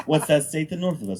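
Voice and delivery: ordinary delivery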